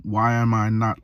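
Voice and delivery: ordinary delivery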